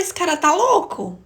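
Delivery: high, drawn-out incredulous question; disbelieving inner thought. Retold or imitated. Woman silently questioning the man's sanity, incredulous and high. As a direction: imitate silent disbelief, pitched well up. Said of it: "Me deu uma tristeza, eu falei".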